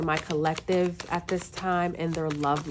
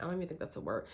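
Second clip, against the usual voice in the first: muttered aside; 12 dB quieter, much faster than usual. Real, not acted. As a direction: drop almost under your breath, doubting yourself. Doubting own word aside to self.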